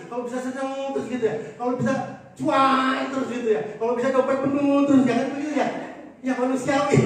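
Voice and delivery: loud, driving sermon cadence; forceful preaching. Real, not acted. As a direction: hammer each line home to the congregation.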